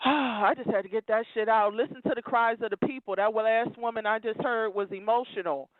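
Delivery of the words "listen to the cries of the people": usual speaking voice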